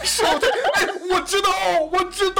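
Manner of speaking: high excited recognition, laughing. Real, not acted. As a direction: blurt it out, thrilled to know.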